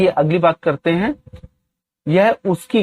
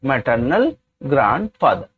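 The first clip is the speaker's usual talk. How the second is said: each word spaced out slowly for students to copy; very slow, deliberate classroom dictation; much slower than usual. Shown as a demonstration. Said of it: "teacher dictates English sentence".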